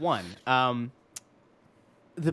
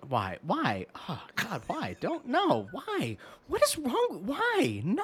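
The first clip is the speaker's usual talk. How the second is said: high, pained cries of why, half joking; exasperated pleading whine; 9 semitones higher, 3 dB quieter, much slower than usual. Real, not acted. Co-host offers silent P words, host whines in mock agony. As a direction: beg him to stop, mock agony.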